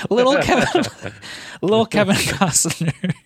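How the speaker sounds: giggling through the name; laughing. Real, not acted. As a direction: laugh while saying it. Laughing at own slip.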